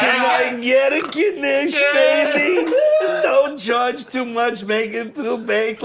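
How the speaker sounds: high, slow, whiny caricature; hammed-up deli voice, very high; mock deli customer. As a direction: plead the order in a drawn-out wail. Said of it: "if I go to Katz's Deli".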